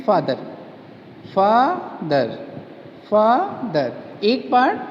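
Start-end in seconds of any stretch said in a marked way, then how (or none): 1.4-2.3 "father" split into two beats, fa, ther; syllable by syllable. Shown as a demonstration. Teacher showing the two parts of father, syllable by syllable. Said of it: "part जो एक बार में बोला जाता है". 3.1-3.9 "father" broken as fa, then ther; syllable by syllable. Shown as a demonstration. Teacher repeating father for students, split by syllable. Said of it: "फा हो गया".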